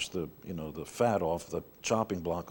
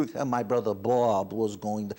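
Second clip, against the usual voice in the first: put-on New York accent; 3 dB louder than usual. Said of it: "all these different sounds in New York".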